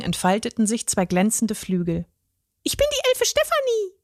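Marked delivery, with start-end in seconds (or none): 2.6-3.9 very high dainty fairy voice; a curtsying fairy presents herself; fairy introducing herself. A narrator's reading. The fairy introduces herself to the troll, very high and proud. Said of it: "stellte sie sich mit einem Knicks vor".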